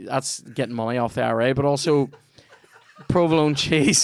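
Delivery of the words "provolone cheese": says provolone cheese through a laugh, louder and higher. Real, not acted. Host to co-host, laughing at his own joke about provolone. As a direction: crack up while getting the word out.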